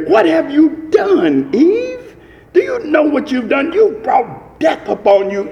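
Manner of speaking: rising, pleading voice of God calling Eve; God confronting Eve. Acted. Preacher voicing God confronting Eve.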